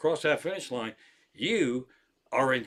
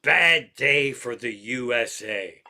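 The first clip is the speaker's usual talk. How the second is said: loud, slow, drawn-out announcement; mock proclamation; 2 semitones lower, 7 dB louder, slower than usual. Reading out Twitter's Olympic verdict, mock proclamation. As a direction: deliver it like a big slow headline.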